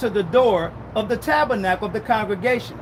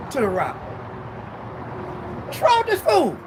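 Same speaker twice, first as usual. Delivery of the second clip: exasperated outburst; 4 semitones higher, 5 dB quieter than usual. Acted. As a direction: throw up your hands at this fool. God exasperated at Moses.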